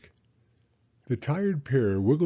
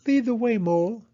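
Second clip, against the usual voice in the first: high, louder little hedgehog; Hedgehog's voice; 13 semitones higher, 5 dB louder than usual. Acted. Hedgehog agreeing to follow Mole, high and a bit louder. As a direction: bravely tell her to lead on.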